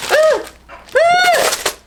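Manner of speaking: sudden shrill startled shrieks; screaming at a spider. Real, not acted. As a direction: shriek in real fright at a bug. Spider lands on her.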